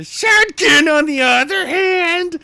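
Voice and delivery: high, strained, loud creature voice; Shadkin voice. Shown as a demonstration. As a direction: squeal it out high and thin. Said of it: "I always went with a really jowly style voice".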